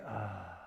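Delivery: long soft sighing ah; dramatic sigh. Shown as a demonstration. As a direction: let it all out in a big sigh. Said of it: "Big sigh".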